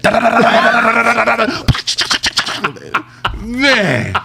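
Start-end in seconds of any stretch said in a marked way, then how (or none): 0.0-3.0 high rapid-fire machine gun noise; imitated gunfire. Showing how the switch sounded on police, imitated gunfire. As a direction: rattle out high staccato shots. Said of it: "that mud was saying".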